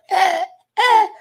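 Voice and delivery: frightened half-laughing, half-crying yelps; high panicky whimpers, laughing through fear; panicked whimpering. Retold or imitated. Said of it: "I'm sitting in my car going".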